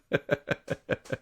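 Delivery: real high, voiceless chuckle; tickled, genuine laughter; amused laugh. Real, not acted. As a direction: laugh in short high bursts at a joke. Amused by the Shakespeare intensive joke.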